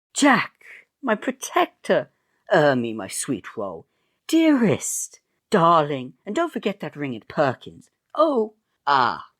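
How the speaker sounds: high gushing lovesick voice; heroine's squealing farewell; swooning maiden. Acted. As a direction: coo like a melodrama heroine.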